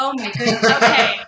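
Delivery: laughing, with a loud okay at the end; mock-polite laugh at the customer; imitating a laughing oh my goodness; laughing disbelief. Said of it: "And I was like".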